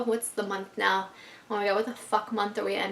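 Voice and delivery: usual speaking voice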